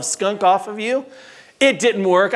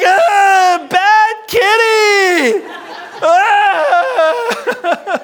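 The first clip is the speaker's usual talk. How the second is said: high, loud wailing scream; comic panicked screaming; horrified shriek; 14 semitones higher, 7 dB louder, much slower than usual. Retold or imitated. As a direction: scold the kitty in a long high scream. Boy shrieking after being sprayed.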